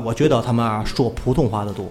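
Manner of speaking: normal talking voice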